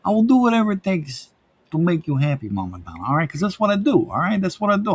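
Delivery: high-pitched, over-earnest pledge to mom; put-on devoted-son voice. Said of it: "If I like talking like this".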